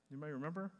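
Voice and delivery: ordinary talk, nothing marked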